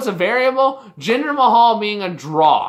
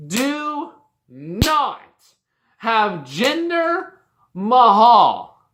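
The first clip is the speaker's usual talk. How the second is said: slow, heavy pauses between "do not", "Jinder" and "Mahal"; emphatic plea; 3 semitones higher, 3 dB louder, much slower than usual. Real, not acted. Fan pleading with bookers, emphatic, stretched-out stress on "do not". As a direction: DO NOT drawn out and higher, word by word.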